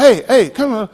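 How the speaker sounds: hollered catcall. Acted. Man hollering at a girl who keeps walking, hollered. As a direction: holler to get a passerby's attention. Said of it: "just holler".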